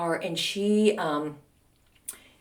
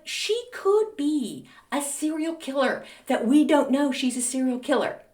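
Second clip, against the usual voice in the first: mock horror; 7 semitones higher than usual. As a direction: draw out the appalled realization. Mock-horrified realization.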